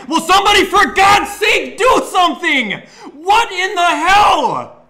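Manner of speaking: high, loud, slow screaming rage; furious shouting. Real, not acted. Gamer screaming at his defense to tackle. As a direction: scream at the players.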